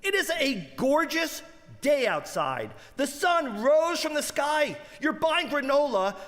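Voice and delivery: high comic rant at a gloomy shopper; incredulous outburst. Retold or imitated. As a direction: blurt it out like a manic cheerleader. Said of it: "I said".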